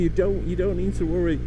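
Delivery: normal talking voice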